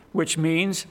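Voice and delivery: normal talking voice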